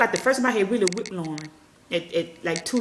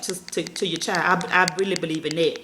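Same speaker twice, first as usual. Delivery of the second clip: ordinary delivery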